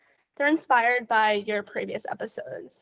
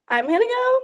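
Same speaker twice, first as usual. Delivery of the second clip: playful high; 12 semitones higher, 3 dB louder than usual. Real, not acted. Building up a niche pick.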